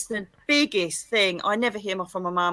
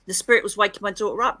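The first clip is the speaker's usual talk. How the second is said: ordinary delivery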